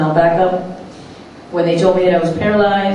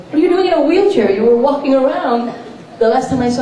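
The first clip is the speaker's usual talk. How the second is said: much higher, startled disbelief; shocked question; 6 semitones higher than usual. Retold or imitated. Patient startled to find her friend in a wheelchair, shocked high question. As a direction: blurt it out in shock.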